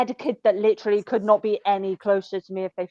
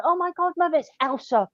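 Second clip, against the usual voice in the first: put-on excited kid; 9 semitones higher than usual. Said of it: "one kid's like".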